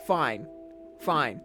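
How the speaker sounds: usual speaking voice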